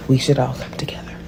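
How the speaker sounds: whispered, mock solemn; sarcastic whisper. Real, not acted. Mocking the unity talk, a sarcastic whisper. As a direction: hush it like a fake sincere plea. Sarcastic aside after laughing.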